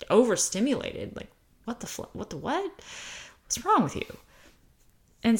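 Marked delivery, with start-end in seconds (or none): 1.1-2.1 whispered gasp. Retold or imitated. Mocking reaction to overstimulation. 2.1-4.2 high incredulous mock; high squeaky what-the-what; mock disbelief. Mocking reaction to overstimulation.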